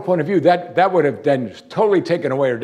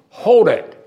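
loud, high "Hold it!" like a stung authority; mock offended Jesus cutting her off; sharp rebuke; 8 semitones higher, 8 dB louder than usual. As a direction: snap out a stop, much higher and louder. Imagined Jesus rebuking Martha.

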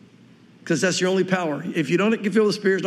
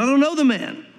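higher-pitched, insistent acted protest; vehement denial; 6 semitones higher than usual. Peter insists he doesn't know Jesus, vehement denial. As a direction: throw it out as an emphatic denial.

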